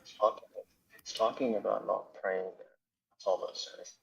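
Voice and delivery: signal cutting out, voice very faint; radio breaking up. Real, not acted. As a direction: voice fading in and out on a bad line. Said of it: "I don't know why your voice is gone".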